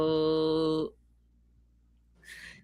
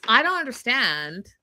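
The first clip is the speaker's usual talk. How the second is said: ordinary talk, nothing marked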